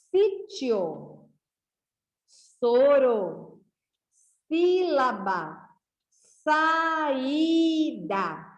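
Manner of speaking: each word said clearly with a pause after it; teacher reading a word list aloud to the class. Shown as a demonstration. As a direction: read the list slowly to children. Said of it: "Vamos começar aqui a leitura".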